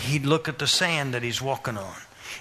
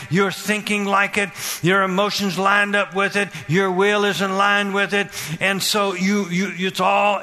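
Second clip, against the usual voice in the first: driving preacher's chant on a raised pitch; preaching at full force; 4 semitones higher than usual. Real, not acted. Preacher urging the congregation to keep hearing it, fervent preaching. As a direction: pile up the phrases, pressing without letup.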